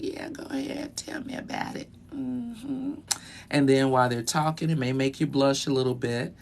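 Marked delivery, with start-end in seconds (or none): 0.0-2.9 soft, airy go-ahead; acted eager consent. Listener agreeing to hear flirty talk, soft coy murmur. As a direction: murmur a willing yes, very softly. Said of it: "You're like".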